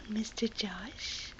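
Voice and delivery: slow soft whisper. Real, not acted. Whispering to the baby.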